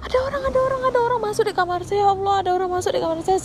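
high, breakneck alarm spilling out; fast, high-pitched frantic report, gradually coming down; retold panic. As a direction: re-enact blurting out an emergency in panic. Re-enacts panicked report of intruder.

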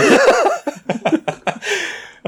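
a squealing, high-pitched burst of laughter. Real, not acted. Host cracking up at his own Aussie cooking-voice joke, squealing laugh.

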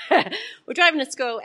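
slow, laughing through the words; laughing start. Real, not acted. Preacher starting a family story, laughing. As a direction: chuckle as the story begins.

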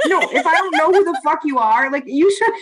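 high indignant rant through laughter; worked-up, laughing outburst. Real, not acted. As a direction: protest while cracking up. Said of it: "you're laughing that hard".

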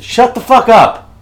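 loud, high, drawn-out yell; exasperated shout. Pretend. Telling off someone who keeps needling, exasperated shout. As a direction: yell it high and drag each word out. Teased repeatedly, snaps back loudly.

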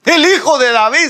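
high, loud calling out; acted shout. Blind man crying out to Jesus, high shout. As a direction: cry out for someone's attention. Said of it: "sí pegaba de gritos".

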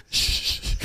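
SH of "Shh" made with the tongue in the way; slushy, thick-tongued "Shh"; lisped shush. Shown as a demonstration. Lisper shows friend the sound he avoids, lisped. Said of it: "you've never heard me go".

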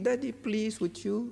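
imitating a child's hushed, gentle plea; soft pleading. Tearful child asks daddy for ice cream, softly pleading. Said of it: "But just pleading".

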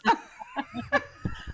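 real, high-pitched laughing fit; delighted squealing laugh; high burst of laughter. Real, not acted. Laughs at guest's accent joke.

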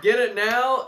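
hard sell, get it now energy; pushy salesman. Acted. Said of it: "This guy sounds like a fucking salesman".

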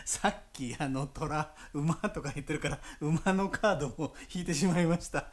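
giggly disbelief; laughing. Real, not acted. Laughing at the coincidence.

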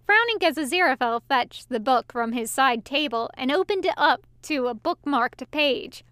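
usual speaking voice

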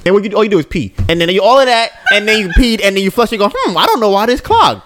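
high, laughing mock of his wife rolling paper. Teasing his wife about her toilet paper, high and laughing. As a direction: ham it up high, giggling through the wife's routine. Acting out wife's toilet habits.

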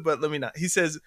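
normal talking voice

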